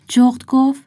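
plain reading voice

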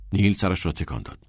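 plain reading voice

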